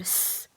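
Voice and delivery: a long hissing "स" like a snake. Shown as a demonstration. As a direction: stretch "स" like a snake's hiss. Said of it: "सांप की तरह". (सांप की तरह लंबा "स")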